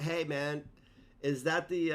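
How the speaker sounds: normal talking voice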